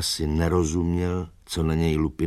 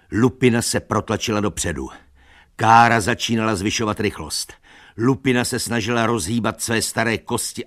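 ordinary delivery, nothing marked

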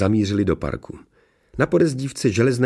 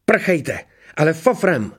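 much higher, raised-voice urging; urgent order; 9 semitones higher, 3 dB louder, slower than usual. A narrator's reading. Someone tells others to flee fast, urgent. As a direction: drive them away at once.

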